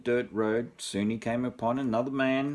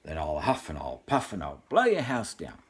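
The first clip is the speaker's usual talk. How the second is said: big growl; 7 semitones lower than usual. Acted. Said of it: "he growled in a very big voice".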